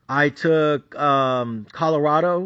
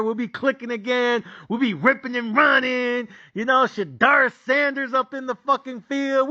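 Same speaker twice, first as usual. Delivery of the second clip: ordinary delivery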